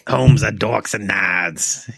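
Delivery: put-on slow Boston accent, drawn-out vowels. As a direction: play a Boston local. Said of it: "with a Boston accent".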